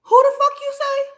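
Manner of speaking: very high, loud incredulous question; hammed-up disbelief, voice way up; stunned outrage. Shocked at ex's name.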